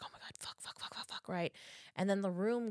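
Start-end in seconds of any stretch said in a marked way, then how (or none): 0.0-1.3 hushed, voiceless panicky swearing; panicked whisper. Retold or imitated. Masseuse hearing someone walking in her house, panicked whisper. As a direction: swear under your breath in a rapid string. Woman hears intruder walking in.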